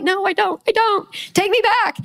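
high-pitched mock-panicked protest; comic pleading to be let off; frantic retraction. Acts out frantic retraction to God.

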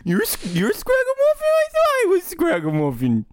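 very high, squealed mock bewilderment; mock confusion. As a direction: squeak it as if totally baffled. Mock confusion over the character names.

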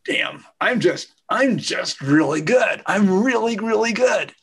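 slow, boastful and over the top; arrogant. Acted. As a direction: brag like a narcissist. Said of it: "That person I just played".

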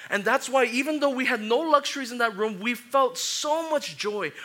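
loud, high, driving preacher voice; fervent pulpit preaching; full-force preaching. Acted. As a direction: preach it hard to the crowd.

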